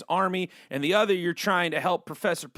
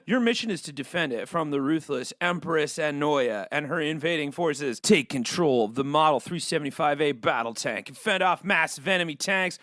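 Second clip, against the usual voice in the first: dramatic trailer read; 5 semitones lower than usual. Acted. Reading game intro dramatically.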